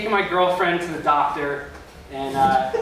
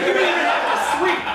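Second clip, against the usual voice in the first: mock sarcastic; 10 semitones higher than usual. Comic mocking the shore resident, high laughing sarcasm. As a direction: needle them with a laughing taunt. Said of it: "And it's like".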